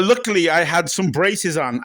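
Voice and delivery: ordinary talk, nothing marked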